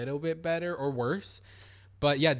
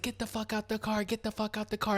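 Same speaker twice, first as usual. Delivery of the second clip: high, softened mock yell, repeated insistently; retold yell; 7 semitones higher, 6 dB quieter than usual. Storyteller imitates the man yelling at the car, softened mock yell. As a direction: mimic yelling with pitch up and volume held down. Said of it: "he's, like, yelling like this".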